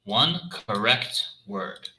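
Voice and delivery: slow, solemn divine voice; goddess voice. Acted. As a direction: speak slowly like a goddess. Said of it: "in a divine voice".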